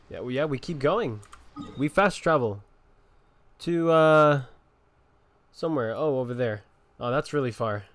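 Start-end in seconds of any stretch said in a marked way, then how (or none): none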